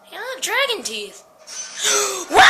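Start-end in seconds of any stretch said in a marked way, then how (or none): 2.3-2.4 startled gasp. Real, not acted. Jump scare in horror game.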